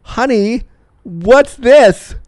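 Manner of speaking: high, lilting mother voice; puzzled mom. Acted. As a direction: play a mom making a puzzling discovery. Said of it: "your mom being like".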